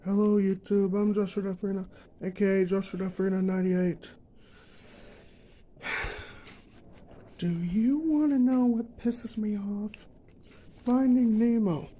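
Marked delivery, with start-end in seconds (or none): none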